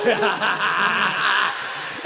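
a put-on laugh acted out for the scene; mock laugh. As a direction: laugh it off like you're hiding tears. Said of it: "you be like".

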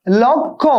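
normal talking voice

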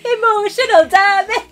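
high comic catchphrase in put-on accent; hammed-up high-pitched dad's exclamation; Stephen He. Woman echoing a YouTuber's catchphrase, hammed-up accent. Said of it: "shout out to Stephen".

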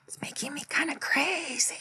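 high, hushed whisper with a playful lilt; silly whisper. Pretend. Giddy host joking about filming too long, silly high whisper. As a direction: whisper it high and loopy, drawing out the last word.

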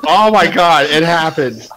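ordinary delivery

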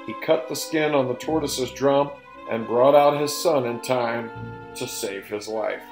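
ordinary delivery, nothing marked

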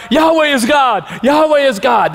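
preacher voicing the people crying out to God; crowd crying out. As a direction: shout it like the whole crowd on the mountain. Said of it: "The people cry out".

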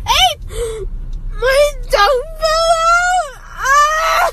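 crying shout. Real, not acted. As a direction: cry out in real distress. Drugged kid panics about dog.

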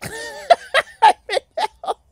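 high, crying laugh; laugh-cry in despair. Real, not acted.

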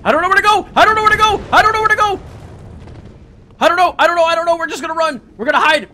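high, loud frantic repetition; panicked yelling. Real, not acted. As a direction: yell it over and over, fleeing in panic. Fleeing in panic, lost.

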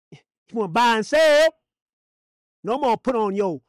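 loud taunting question; loud preacher's challenge. Acted. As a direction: belt it out, stretching SELL. Taunting end-times buyer in sermon.

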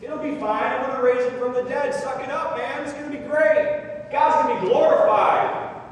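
jolly the mourners along, tactlessly; hammed-up insensitive rallying cry; mock pep talk. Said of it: "does he say".

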